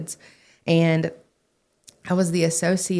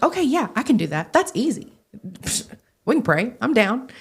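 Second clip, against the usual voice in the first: higher, bubbly mock-eager agreement; eager excited yes; 6 semitones higher than usual. Retelling her eager reply to the pastor, bright and excited. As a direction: chirp it back like a keen kid.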